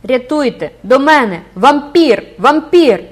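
loud, high desperate screams; screaming for help. A narrator's reading. Esmeralda calls for help against the priest, desperate scream. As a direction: scream with the last of her strength. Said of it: "зробила останні зусилля і закричала".

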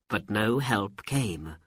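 ordinary delivery, nothing marked

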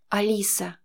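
shaky, frightened little whisper of her name; terrified hostage barely getting it out; trembling whisper. A narrator's reading. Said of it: "дрожащим голосом прошептала она".

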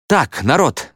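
high call to a crowd; understated holler; mild yell. A narrator's reading. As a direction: raise your voice to clear people away. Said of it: "завопил Мо толпе".

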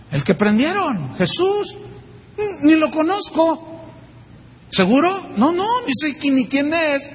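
mock nervous denial, pitch jumping up on Jesús. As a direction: deny it quickly, caught and jittery. Pedro niega a Jesús. (negación nerviosa, aguda en Jesús)

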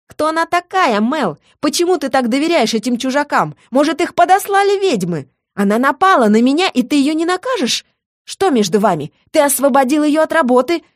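high, jealous tirade; jealous woman's hysterical outburst; hysterical accusations. A narrator's reading. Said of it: "Обвинения смешались в кучу".

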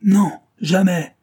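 loud, high, drawn-out refusal; acted anguished protest; cried refusal. A narrator's reading. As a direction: shout it in defiance. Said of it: "cria encore Nick".

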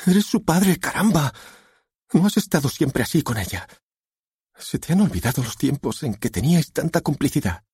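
high-pitched, exasperated wife; reproachful wife. A narrator's reading. Wife reproaches husband in story.

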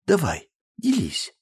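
slow, high, smiling nudge; kind smile. Acted. Friend kindly urging Anna to share. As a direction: coax her gently. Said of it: "По-доброму улыбнулась подруга".

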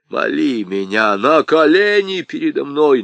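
loud raging order, «колени» hit hard; angry command. Acted. Аргылов требует встать на колени. («колени» с громким нажимом)

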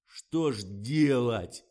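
slow, loud, helpless question; at a loss. A narrator's reading. Director is stumped by the problem, bewildered. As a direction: drag it out in dismay. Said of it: "растерялся Фомин".

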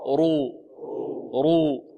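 رو with a heavy r. Shown as a demonstration. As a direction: drill رو with a full heavy r. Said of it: "انطقوا معي".

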